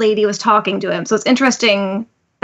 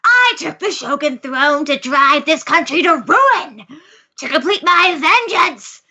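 slow, high, hammed-up villain declaration; drawn-out villain monologue, not deep at all; 5 semitones higher, slower than usual. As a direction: declaim like an amateur stage villain. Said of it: "this could be deeper, but I can't do that".

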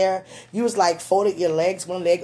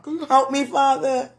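flustered plea; 7 semitones higher, 3 dB louder than usual. Real, not acted. Flustered mock prayer for help.

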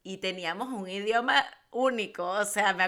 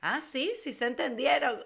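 retold surprise; 6 semitones higher than usual. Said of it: "Y mi prima como que".

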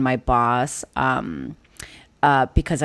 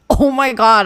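higher, holding back a laugh-cry; suppressed laugh-cry; 6 semitones higher, 3 dB louder than usual. Retold or imitated. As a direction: imitate fighting down nervous laughter. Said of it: "force my laugh cry to not happen".

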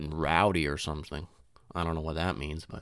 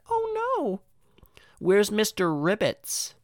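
much higher, slow mock-fretting teacher; worried teacher; 13 semitones higher, slower than usual. Teacher fretting to the class over the missing toad, high and slow. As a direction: fret over the lost toad. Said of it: "our teacher would be like".

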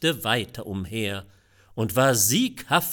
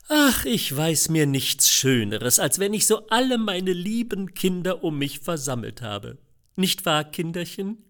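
louder, higher fond father; sentimental father; 7 semitones higher, 5 dB louder than usual. A narrator's reading. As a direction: beam over the gathered family. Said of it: "sah sich der Vater im Kreise um und sprach". (rührseliger Vater im Familienkreis)

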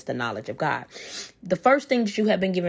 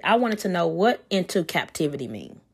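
ordinary talk

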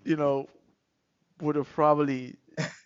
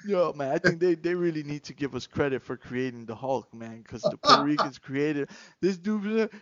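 ordinary delivery

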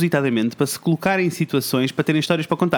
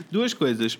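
ordinary delivery

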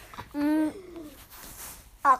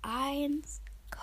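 quiet countdown; 10 dB quieter, much slower than usual. Shown as a demonstration. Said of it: "leise sein".

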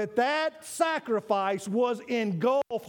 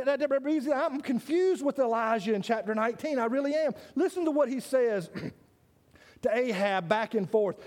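usual speaking voice

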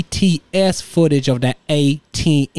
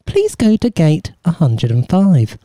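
airport announcement; 6 semitones lower than usual. Pretend. Mock airport announcement for holiday.

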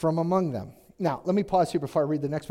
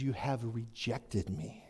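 much lower, much softer, slow voice of God; hurt God; 8 semitones lower, 13 dB quieter, slower than usual. Acted. God telling Israel they rejected him, hushed and slow. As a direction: let it sink like a wounded father. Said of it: "God says".

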